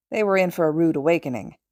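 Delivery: usual speaking voice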